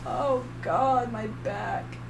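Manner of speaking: softly groaned, trailing away; quiet pained complaint. Real, not acted. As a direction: wince through a sore back. Back hurts while talking.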